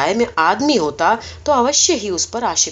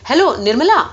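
higher, louder eager greeting; eager hello; 5 semitones higher, 5 dB louder than usual. Acted. Superintendent calls the operator back, eager and loud. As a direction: call her name like you can't wait.

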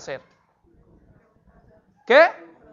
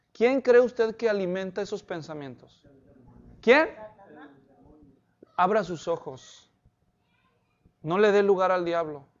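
normal talking voice

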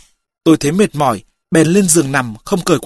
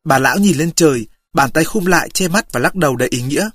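ordinary delivery, nothing marked

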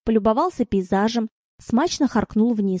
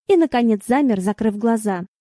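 ordinary delivery

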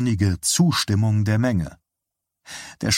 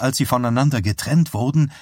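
plain reading voice

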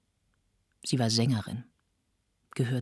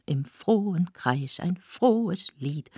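slow, higher, declaimed verse; festive table-song recital; 6 semitones higher, slower than usual. A narrator's reading. Poet opening his table song for guests, slow festive recital. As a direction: announce the song with ceremony. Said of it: "ein kleines Tafellied".

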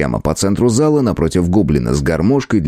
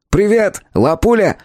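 shouting; 13 semitones higher than usual. A narrator's reading. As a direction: call out over the music. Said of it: "прокричал Джодек".

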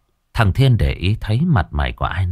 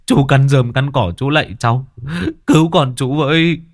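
desperate plea; 5 semitones higher than usual. Acted. Father begging on his knees.

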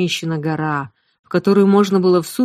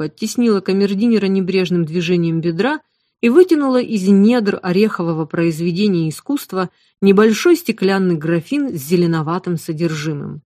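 ordinary delivery, nothing marked

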